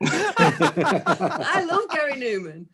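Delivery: laughing slowly through it; can barely get it out for laughing. Real, not acted. Host correcting a name while laughing, slow.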